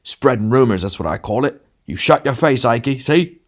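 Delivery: big bully bellowing orders; shouting big man. A narrator's reading. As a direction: shout them down with a threat. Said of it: "shouted the big man".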